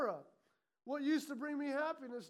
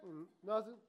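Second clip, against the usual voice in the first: evasive denial; 5 semitones lower than usual. Acted. Caught murmurer answers the challenge, evasive and low. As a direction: brush it off, caught muttering.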